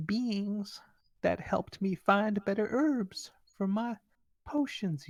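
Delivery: put-on a proud old healer justifying her trade; elderly gnome healer.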